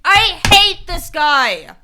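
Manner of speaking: slow, loud furious growl; real fury at the boss; angry yelling. Real, not acted. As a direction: spit out the hatred word by word. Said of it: "I get I'm yelling".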